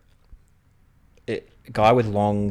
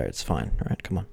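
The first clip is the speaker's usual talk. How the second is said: ordinary talk, nothing marked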